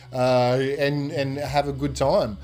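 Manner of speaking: normal talking voice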